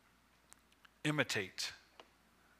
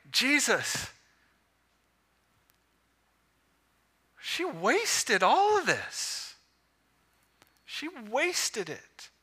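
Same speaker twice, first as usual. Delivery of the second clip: high, slow, drawn-out complaint; indignant disciples; 8 semitones higher, much slower than usual. Retold or imitated. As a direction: stretch it out in swooping, scandalized protest. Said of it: "And indignation towards her".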